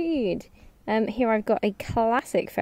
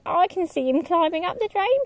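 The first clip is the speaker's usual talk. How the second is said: higher, fast little pig squeal; 9 semitones higher, faster than usual. Acted. Second pig warning the others, a quick mild squeal. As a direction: rush it out in alarm. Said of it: "squealed the second pig".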